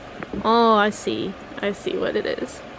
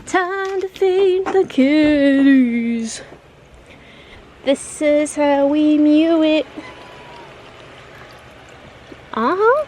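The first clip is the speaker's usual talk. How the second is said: singsong cat feeding; 4 semitones higher, 4 dB louder, slower than usual. Real, not acted.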